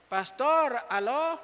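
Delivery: high-pitched mock calling out; pretend phone call. Parent pretending to phone the pastor, mock high call. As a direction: play-act ringing someone up, pitched well up.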